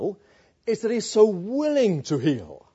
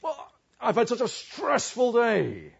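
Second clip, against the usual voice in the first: mock-aggrieved, raised voice on an excuse; exasperated excuse-making; angry excuse; 5 semitones higher, 4 dB louder than usual. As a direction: blurt out a self-justifying grumble, pitched up. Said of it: "But I get angry. Why? Well, you say".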